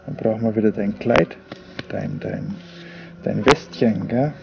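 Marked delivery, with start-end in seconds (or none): none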